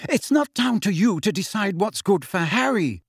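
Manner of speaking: high-pitched, sharp rebuke. A narrator's reading. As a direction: put your foot down sharply, pitch up. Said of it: "said Missus Weasley sharply".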